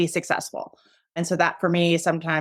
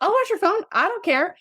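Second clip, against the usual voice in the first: much higher-pitched, airy offer; breezy offer; 13 semitones higher than usual. Acted. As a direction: toss it out high, as no trouble at all. Acted breezy offer to a nurse.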